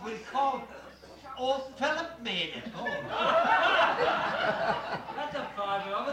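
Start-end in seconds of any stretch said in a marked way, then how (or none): 2.9-5.1 squealing laugh; high burst of laughter. Real, not acted. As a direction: shriek with laughter. Cake named Philip, crowd laughs.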